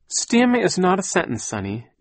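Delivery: high, loud stern correction. A narrator's reading. Aunt correcting a child's baby talk, stern. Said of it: "Aunt Josephine said sternly".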